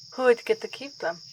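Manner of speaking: normal talking voice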